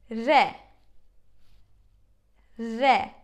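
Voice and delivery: "re" with a light Turkish R; soft Turkish R. Shown as a demonstration. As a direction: say "re" without a strong American R. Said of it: "It's not that strong".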